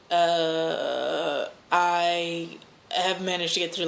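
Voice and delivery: usual speaking voice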